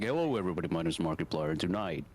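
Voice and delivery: low-pitched YouTuber intro impression; Markiplier. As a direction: drop the voice for a streamer greeting. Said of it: "drop it like".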